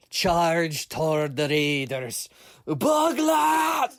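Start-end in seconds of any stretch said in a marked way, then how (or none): 2.6-4.0 high, loud screaming battle cry; war scream. Acted. Bug hero charging the raiders, screaming his name. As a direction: scream your own name as you charge. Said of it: "screaming his own name".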